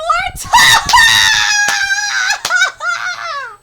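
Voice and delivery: long, high, loud cackle of delight; helpless loud laughing fit; uproarious laughter. Real, not acted. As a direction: burst into a long shrieking laugh. Laughs at middle schooler insult.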